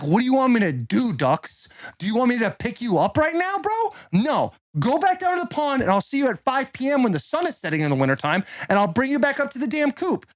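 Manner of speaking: high, indignant talking-to for the ducks; exasperated duck owner. Acted. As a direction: plead and scold with pitch swooping up. Scolding his ducks in a story.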